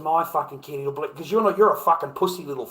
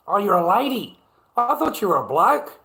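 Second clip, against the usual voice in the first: surprised realisation; 5 semitones higher, 4 dB louder than usual. Real, not acted. Realises a chatter is a woman.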